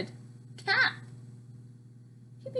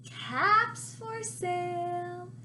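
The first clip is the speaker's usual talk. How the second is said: slow, singsong street call, higher; peddler calling out; 6 semitones higher, 3 dB louder, slower than usual. A narrator's reading. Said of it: "he called".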